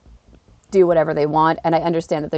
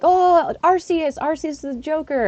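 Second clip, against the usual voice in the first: put-on higher-pitched excited child; excited kids; 8 semitones higher than usual. Retelling pupils spotting the Joker, excited kids. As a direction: imitate kids spotting a familiar card. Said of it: "the kids are like".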